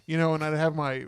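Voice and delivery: normal talking voice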